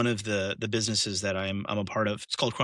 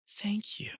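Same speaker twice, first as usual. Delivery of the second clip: soft thanks; 9 semitones higher, 8 dB quieter than usual. Retold or imitated. Wife voiced in retold video.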